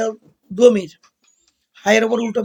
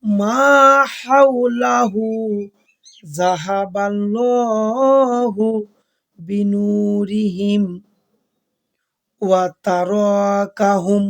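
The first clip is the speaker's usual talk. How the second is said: very slow, long-held chanted tilawat; melodic Quran recitation; much slower than usual. Shown as a demonstration. Teacher reciting the whole passage before correcting it, slow melodic chant. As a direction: sing out the verse with tajweed, stretching each vowel.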